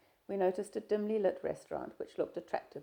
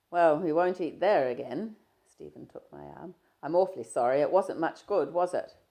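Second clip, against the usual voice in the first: a louder, lightly voiced young man, breezy; Stephen, teasing; 9 dB louder than usual. Stephen greeting his shy friend, easy and teasing. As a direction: play Stephen chatting easily, a touch louder.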